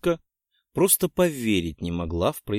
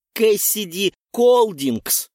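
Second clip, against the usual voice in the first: higher, louder, slow full-name call; stern summons; 10 semitones higher, 6 dB louder, slower than usual. A narrator's reading. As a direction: draw the name out like a verdict. Witch summons the culprit.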